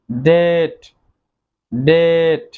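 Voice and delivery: ডেট said slowly and clearly, with the b silent; careful pronunciation. Shown as a demonstration. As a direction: model the word ডেট for the class. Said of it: "Then রয়েছে".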